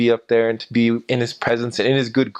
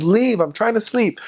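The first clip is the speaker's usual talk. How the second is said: high-pitched mock yell of an irritated neighbor; retold angry scream; 12 semitones higher than usual. Woken neighbor yelling at missionaries at his door, high-pitched angry mock yell. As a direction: shriek it like a woken man in pajamas. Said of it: "he just screamed at us".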